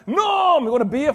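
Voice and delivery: loud cheerful bar greeting, high on Norm; calling out Norm's name. Acted. Bar crowd greeting Norm as he walks in, loud and cheerful. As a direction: hail him across the bar.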